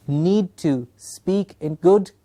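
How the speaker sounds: need to speak in good, stretched slowly with weight on good; slow emphasis on good. Shown as a demonstration. Trainer slowing down to stress good English to teachers. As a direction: spell out each word slowly, leaning on good.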